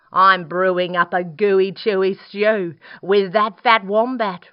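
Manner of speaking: loud, crafty dingo; booming scheming dog character. Acted. Dingo voiced in story.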